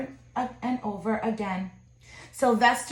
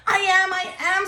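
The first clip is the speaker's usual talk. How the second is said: high, loud eager cry; shouted; 8 semitones higher, 3 dB louder than usual. Acted. Son answering his mother, high eager shout. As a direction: shout it out hopefully. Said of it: "Sylvester shouted".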